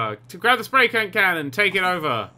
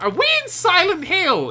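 very high-pitched squeal of a question; alarmed disbelief; 12 semitones higher, 4 dB louder than usual. Real, not acted. Player finding the game turned creepy, alarmed disbelief. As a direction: squeak it out, aghast. Mock horror at creepy scenery.

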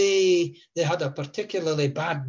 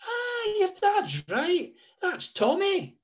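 high falsetto mother recognising someone; mother's voice; slower than usual. Retold or imitated. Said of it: "she said".

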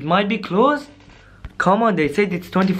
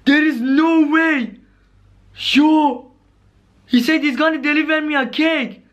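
higher-pitched, stunned exclaiming; shocked disbelief; 4 semitones higher than usual. Acted. Caller telling viewers about clown's delivery, shocked. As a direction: react in alarmed disbelief.

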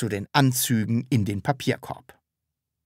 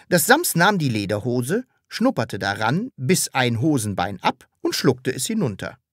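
ordinary delivery, nothing marked

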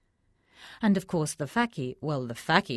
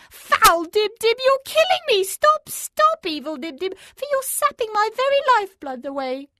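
whimpering crone; 9 dB louder than usual. A narrator's reading. Old woman alone writhing in bed, high whimpering wail. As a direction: wail as if the curse is sucking her dry. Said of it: "her whimpering voice".

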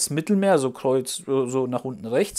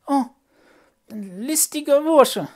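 high, slow, loud puppet voice on "ein listiger Bursche"; marvelling puppet; 13 semitones higher, 6 dB louder, slower than usual. Acted. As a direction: savour "listiger Bursche" in awe. Puppet repeats the narrator.

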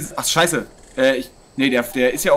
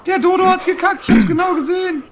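much higher, amused squeal; excited. Real, not acted. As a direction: squeal it out with glee. (hoch und aufgeregt)

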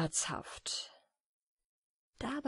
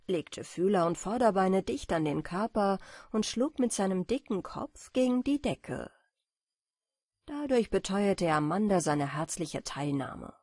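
plain reading voice

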